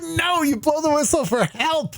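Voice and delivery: high-pitched, exasperated correction; retold indignant objection; indignant protest. Acted protest over a joke.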